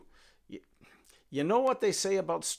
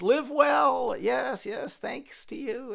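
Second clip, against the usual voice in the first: cheerful thanks; 9 semitones higher, 8 dB louder, much slower than usual. Acted. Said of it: "he says".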